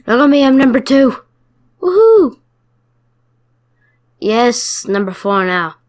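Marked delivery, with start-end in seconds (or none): 0.1-2.4 excited cheer. Real, not acted. Reaching leaderboard number two.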